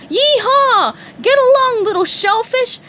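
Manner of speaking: high, loud Texas yee-haw; Texas cowgirl holler. A narrator's reading. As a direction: holler like a Texan rider. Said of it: "Sandy cried".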